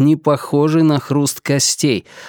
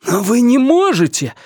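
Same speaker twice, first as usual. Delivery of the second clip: exclaiming; 10 semitones higher than usual. Acted. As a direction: cry out in protest. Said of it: "воскликнул Ренар". (возмущённое восклицание)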